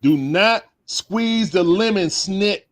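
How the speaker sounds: slow, high pleading catchphrase. Pretend. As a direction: drag it out, begging. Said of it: "y'all know what I'm gonna say".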